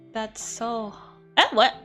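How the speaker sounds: normal talking voice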